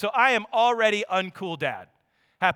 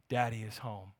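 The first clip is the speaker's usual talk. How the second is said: tender; 9 semitones lower, 10 dB quieter than usual. Acted.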